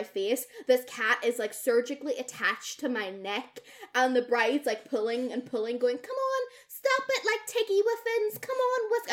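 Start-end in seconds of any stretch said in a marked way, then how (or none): 6.0-9.1 high sing-song pet coaxing; coaxing the cat. Retold or imitated. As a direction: coo at the cat to let go. Bride coaxing cat off her.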